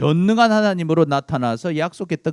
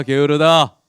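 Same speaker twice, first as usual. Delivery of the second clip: 게으르다 pushed out louder and falling; louder Pharaoh taunt; 5 dB louder, slower than usual. Acted. Pharaoh scolding the slaves again, louder and harsher. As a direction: bark 게으르다 harder the second time.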